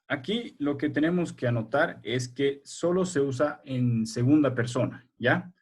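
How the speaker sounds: normal talking voice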